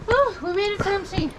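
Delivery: happy whoop on arrival; cheer, relieved to arrive; excited cheer. Real, not acted. Arriving after a hard hike.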